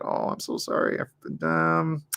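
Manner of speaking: hushed, breathy apology; sheepish apology. Retold or imitated. Apologising to someone whose name slipped, sheepish and hushed. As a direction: apologise under your breath, embarrassed.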